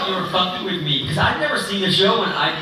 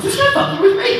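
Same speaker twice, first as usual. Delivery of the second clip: much higher, baffled disbelief; high incredulous voice; 3 dB louder than usual. Retold or imitated. Actor recalling thinking a co-star was messing with him. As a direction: squeak it in startled confusion. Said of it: "and I'm like".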